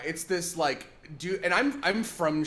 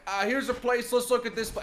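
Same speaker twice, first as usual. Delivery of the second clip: higher, lightly voiced Chicago guy; imitating the botanist; 10 semitones higher, 4 dB louder than usual. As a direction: play the excited plant guy, light touch. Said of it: "films himself, like, going like".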